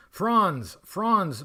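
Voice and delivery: ordinary delivery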